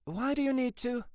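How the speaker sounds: very high, alarmed question. A narrator's reading. Sergeant questioning boss's request, high and alarmed. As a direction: query it nervously in a high pitch. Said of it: "alarmed by the request".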